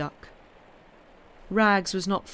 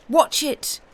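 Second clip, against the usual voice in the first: shouted; 9 semitones higher, 6 dB louder than usual. A narrator's reading. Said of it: "shouted Pat".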